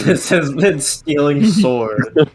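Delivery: drawn-out, laughing sign-off; mock show outro. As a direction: sign off like a show host, amused.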